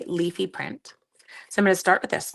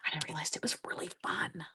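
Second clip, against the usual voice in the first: whispering; 4 semitones lower, 14 dB quieter than usual. Real, not acted. Whispered confession in story.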